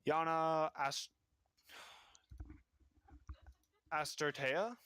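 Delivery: Ast- cut off, then Astartea tried with a rise; mangled name. Acted. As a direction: fumble the name, then guess at it. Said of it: "mispronouncing name".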